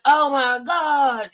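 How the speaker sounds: drawn-out, high mock wail; high, stretched tearful lament; retold crying. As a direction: imitate yourself crying out. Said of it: "crying like a mug yesterday".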